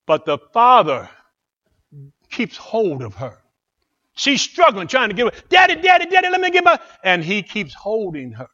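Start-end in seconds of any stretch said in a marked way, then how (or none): 5.5-6.8 put-on high, loud pleading little girl; desperate child. Little girl begs daddy to let her chase the money, high and pleading. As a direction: beg like a five-year-old losing her money. Child struggling in father's grip.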